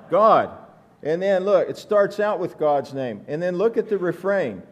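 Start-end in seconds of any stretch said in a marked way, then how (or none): 0.1-0.5 "God." punched out loud as a single word; stress on God. Shown as a demonstration. Preacher pointing the congregation to the psalm's first word. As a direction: hit "God" hard and alone. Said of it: "First word in the psalm".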